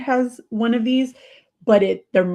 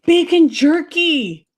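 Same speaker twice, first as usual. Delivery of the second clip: excited announcement; 7 semitones higher, 7 dB louder, much slower than usual. Real, not acted. Announcing her favourite snack.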